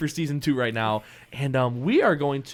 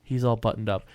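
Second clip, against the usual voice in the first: normal talking voice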